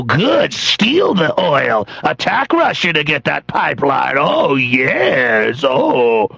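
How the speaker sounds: lightly voiced gloating citizen, slow on steal the oil; a touch of hammed-up relish at war for oil; mock greedy American. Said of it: "And so they go".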